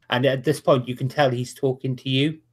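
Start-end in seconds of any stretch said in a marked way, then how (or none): none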